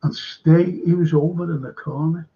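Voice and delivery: ordinary talk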